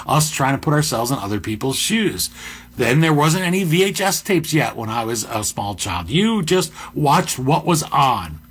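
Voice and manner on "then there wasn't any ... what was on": usual speaking voice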